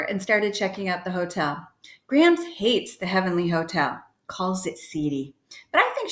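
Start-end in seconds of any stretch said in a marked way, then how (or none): none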